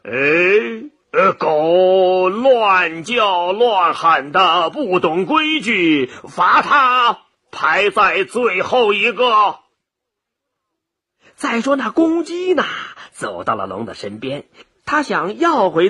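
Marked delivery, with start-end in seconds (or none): 0.0-9.6 slow, drawn-out imperious rebuke; angry emperor. Acted. Jade Emperor punishes the noisy dog, slow and angry. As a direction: pronounce sentence with stretched, weighty words. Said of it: "玉皇大帝生气了".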